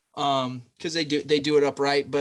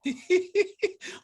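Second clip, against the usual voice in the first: high laugh. Real, not acted. Reacting to a joke.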